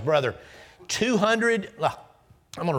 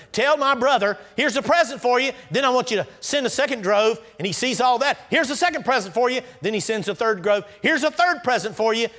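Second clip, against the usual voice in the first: eager gift messages; 10 semitones higher, 5 dB louder than usual. Acted. Jacob's messages to Esau.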